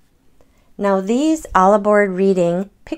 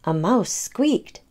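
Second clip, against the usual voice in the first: ordinary delivery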